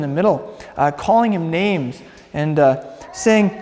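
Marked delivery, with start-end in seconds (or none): none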